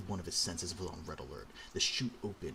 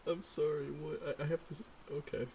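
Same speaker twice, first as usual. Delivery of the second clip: giggling disbelief; 9 semitones higher than usual. Real, not acted. Still laughing in disbelief.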